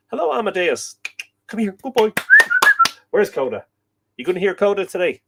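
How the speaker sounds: put-on high, playful voice for a good boy; talking to a dog. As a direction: coax the dog over, high and sing-song. Greeting viewer's dog Amadeus.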